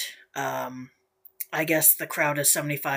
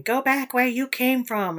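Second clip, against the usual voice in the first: higher mock voice; mocking a Twitter woman; 8 semitones higher, 3 dB louder than usual. Mocking a woman on Twitter telling protesters to leave, higher voice. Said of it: "some chick was, like, saying".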